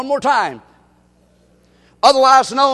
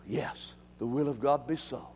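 quiet resignation; 12 semitones lower, 14 dB quieter than usual. Acted. Paul's calm resigned reply.

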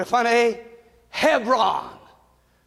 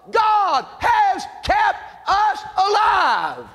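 slow preaching shout; 7 semitones higher, much slower than usual. Real, not acted. Preacher drawing out God's keeping to the congregation. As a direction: drive it home slowly.

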